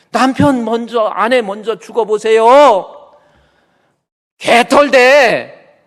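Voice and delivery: high, slow, booming admonition; loud warning. Acted. Preacher warning couples about losing a spouse, loud admonition. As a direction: drive the warning home, stretching the last words.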